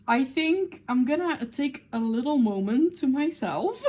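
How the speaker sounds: overwhelmed, breaking up at the end; tearful laugh. Real, not acted. Reader excusing themself after the ending, tearfully laughing. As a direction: voice climbing high into teary laughter. Overcome after finishing the book.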